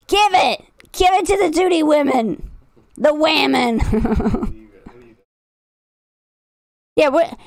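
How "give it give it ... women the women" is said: raised, pushy mock demand; hammed-up cultish shouting; mock zealous shout. Chat told to pay the women, mock zealous shout.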